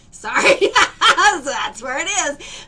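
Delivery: apologising through high, loud laughter; laughing. Real, not acted. Healer laughing as she finds the spot. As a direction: giggle the words out.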